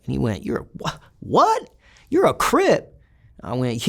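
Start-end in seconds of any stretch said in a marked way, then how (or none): 0.4-2.9 imitated disbelief, leaping very high; high-pitched mock astonishment, starting with a faltering false start; incredulous. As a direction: act out a stunned double take. Acted disbelief at the claim.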